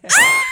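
high, loud yelp of amusement; playful shriek. Real, not acted. Host reacting to producer's joke, playful shriek. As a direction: let out a sharp, high squeal.

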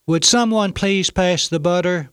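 small voice trying a bit harder. A narrator's reading. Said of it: "just a little louder than before".

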